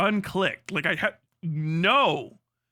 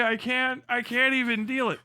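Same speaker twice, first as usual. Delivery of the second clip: normal talking voice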